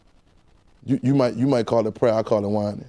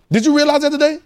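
much higher question; high call; 14 semitones higher, 4 dB louder than usual. Acted. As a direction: lift it up to the church. Preacher calls out question.

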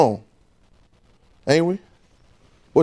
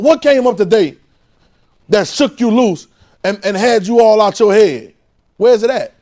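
higher, louder preaching; 8 semitones higher, 7 dB louder than usual. Acted. Preaching at full force.

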